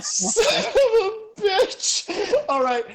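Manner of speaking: high, slow, laughing yell; laughing outburst. Real, not acted. GM reacting to the player's lucky twist, laughing loud. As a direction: crack up and cry it out. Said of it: "Fuck yes".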